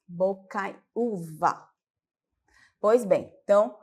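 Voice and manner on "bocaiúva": Bocaiúva drawn out slowly, stress on ú; slow syllable stress. Shown as a demonstration. Teacher slowly repeating Bocaiúva for students. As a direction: stretch Bocaiúva so the stressed ú stands out. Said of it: "Nós temos aí bocaiúva".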